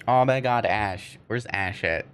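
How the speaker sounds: ordinary delivery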